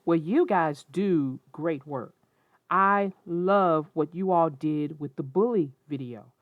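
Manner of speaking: plain reading voice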